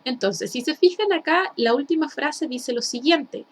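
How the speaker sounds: ordinary talk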